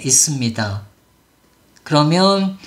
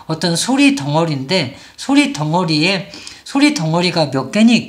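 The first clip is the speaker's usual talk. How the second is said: ordinary talk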